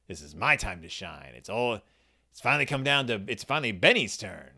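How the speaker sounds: voz mais grave e satisfeita, como o Ben orgulhoso; low, self-satisfied murmur, lightly played Ben. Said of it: "Ben's just like".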